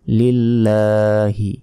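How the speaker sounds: very slow "লিল্লাহি" with লা held a second; drawn-out lam. Shown as a demonstration. Teacher showing the elongated লা, slow "লিল্লাহি". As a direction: hold the "লা" in "লিল্লাহি" for a full beat. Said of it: "লা এক second টেনে পড়তে হবে".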